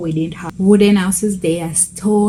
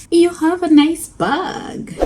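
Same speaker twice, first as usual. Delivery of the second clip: retold compliment; 10 semitones higher, slower than usual. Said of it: "I was like".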